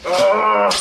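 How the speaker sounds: loud, high, strained groan of disgust. Real, not acted. Seeing the ruined mask, loud disgusted groan. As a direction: let out a big fed-up ugh.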